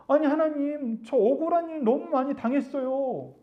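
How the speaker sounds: high-pitched acted plea; plaintive complaint. Believer telling God of many injustices, plaintive complaint. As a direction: raise the voice high and draw out a mock-aggrieved appeal.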